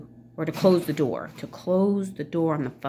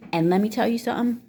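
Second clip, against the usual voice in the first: ordinary delivery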